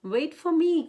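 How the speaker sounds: high, loud little call. A narrator's reading. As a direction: cry after the others not to be left behind. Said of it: "cried Mouse".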